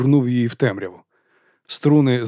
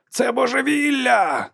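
high, slow shouted outburst; shouting; 13 semitones higher, slower than usual. A narrator's reading. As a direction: yell it out in alarm, much higher. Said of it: "кричав спереду Толос".

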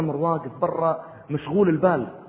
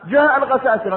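higher, louder alarm; alarmed outcry; 9 semitones higher, 6 dB louder than usual. Retold or imitated. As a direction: cry out that the enemy has come.

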